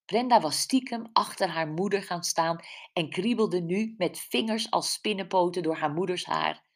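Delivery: plain reading voice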